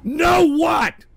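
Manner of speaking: high, loud demand; loud shout. Real, not acted. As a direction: throw it back loud. Yelling at the soundboard.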